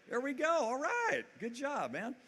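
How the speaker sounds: high, loud delighted cheer for the hands going up; excited cheer. Real, not acted. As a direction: hype up the crowd's response.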